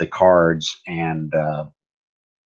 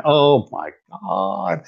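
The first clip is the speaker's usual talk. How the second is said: dismayed; 10 semitones higher, much slower than usual. Real, not acted. Dismayed at the dice result.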